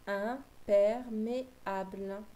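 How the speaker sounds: slow, careful "imperméable" with each syllable drawn out; "imperméable" said slowly, syllable by syllable; slow careful reading. Shown as a demonstration. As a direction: spell the word out slowly for a child. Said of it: "Quel mot peut-on former".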